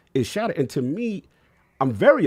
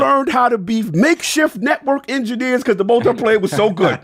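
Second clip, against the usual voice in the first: much higher and louder, impassioned; emotional; 10 semitones higher, 7 dB louder than usual. Real, not acted. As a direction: shout your love for the game. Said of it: "'cause I'm getting emotional".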